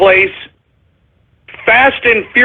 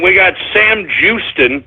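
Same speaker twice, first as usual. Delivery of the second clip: usual speaking voice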